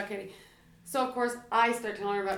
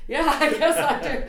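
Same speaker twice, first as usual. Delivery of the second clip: real laughter breaking through the words, louder and higher; giggling out the admission, then rushing on; laughing through an embarrassed confession; 8 semitones higher, 13 dB louder than usual. Real, not acted.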